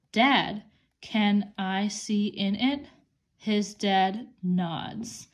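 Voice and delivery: put-on slow, word-by-word reading for kids; reading slowly. Shown as a demonstration. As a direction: read each word slowly and clearly.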